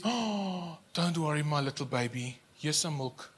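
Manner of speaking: put-on soft, cooing mother comforting a crying child; soothing mummy. Mother soothes her tantrum-throwing baby, softly cooing. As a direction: hush the little one like a mum. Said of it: "the mummy comes and says".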